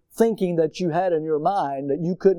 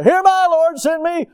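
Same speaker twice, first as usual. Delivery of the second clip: high, loud eager cry; mock fervent cry to the Lord; calling out; 11 semitones higher, 6 dB louder than usual. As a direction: shout it like a volunteer. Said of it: "we were calling out there".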